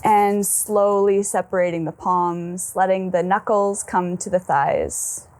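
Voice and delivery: slow, settled meditation voice; guided meditation. Acted. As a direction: ease them inward with long, calm pauses.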